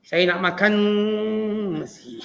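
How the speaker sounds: Malay words drawn out as in Quran recitation; stretched like tajwid. Shown as a demonstration. Lecturer mocking Malay stretched with tajwid, drawn out. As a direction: stretch each syllable like reciting harakah. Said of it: "tuan-tuan panjangkan dia ikut makna harakah".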